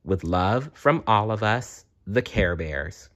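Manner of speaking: ordinary delivery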